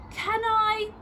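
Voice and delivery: put-on high, wheedling little boy; pleading child. A narrator's reading. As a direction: beg like a small child. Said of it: "sighed Missus Large".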